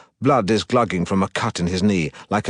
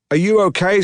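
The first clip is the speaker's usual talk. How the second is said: put-on high, louder, a light French accent; French woman; 11 semitones higher, 5 dB louder than usual. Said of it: "a passing French woman".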